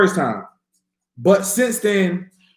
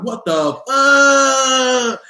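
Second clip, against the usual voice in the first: high, slow, laughing "what the"; a stunned laughing gasp of a curse; shocked laughing outburst; 7 semitones higher, 3 dB louder, much slower than usual. Retold or imitated. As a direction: stretch it out in amazed disbelief. Retelling his shock at leaving his body.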